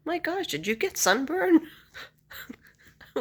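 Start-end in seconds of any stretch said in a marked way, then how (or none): 0.0-1.7 high, loud, startled question; surprised boyfriend. Retold or imitated. Devin seeing her red cheeks, high and alarmed. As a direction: blurt it out in alarm. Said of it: "he would say".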